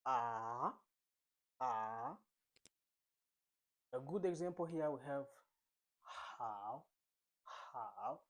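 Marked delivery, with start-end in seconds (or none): 0.0-2.2 Mandarin third tone on a long AH, falling then climbing. Shown as a demonstration. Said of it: "We fall down and rise up". 6.4-8.2 HOW dipping low, then rising; third tone. Shown as a demonstration. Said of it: "The third tone".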